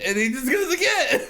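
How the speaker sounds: high, squealed through laughter; words dissolving into laughter; helpless laughing. Real, not acted. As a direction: lose it while finishing the story. Helpless laughter at story's climax.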